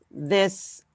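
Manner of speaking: ordinary talk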